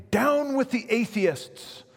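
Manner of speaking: high, slow, commanding proconsul; the proconsul dictating the words to recant; the proconsul says it as an order to deny his faith; proconsul's demand. Retold or imitated. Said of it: "he began to persuade him, saying".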